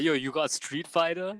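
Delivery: lightly voiced gamer bro; a hint of a cocky bro; douchey bro voice. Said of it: "This, this is how he was talking".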